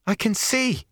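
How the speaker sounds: very high, thrilled exclamation; amazed cry at sight returning; exclaiming. A narrator's reading. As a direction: burst out with it, voice leaping up. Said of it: "Matt exclaimed".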